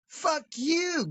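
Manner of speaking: high, mock-defiant kid retort; mouthy kid. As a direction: lightly imitate a bratty kid. Kid insults narrator.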